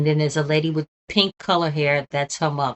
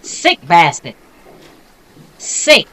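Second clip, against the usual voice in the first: disgusted shout; 10 dB louder, much slower than usual. Real, not acted. Streamer cursing the killer during sentencing, a loud disgusted shout. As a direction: let the revulsion out loud.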